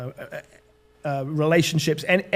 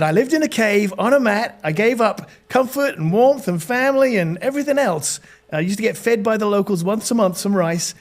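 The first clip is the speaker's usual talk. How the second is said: put-on higher, animated American accent; put-on American, pitched up; 5 semitones higher than usual. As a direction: imitate an American stranger telling his tale. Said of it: "this is my American accent".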